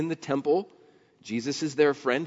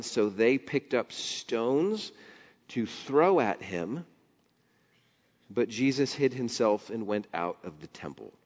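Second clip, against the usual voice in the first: slow scripture reading; 3 semitones lower than usual. Acted. Preacher reading the verse to the congregation, slow. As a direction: read it slowly and deliberately.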